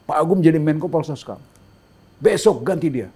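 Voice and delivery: mock presidential order out of nowhere; imitating the president. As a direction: announce it like a boss springing news. President announces reshuffle.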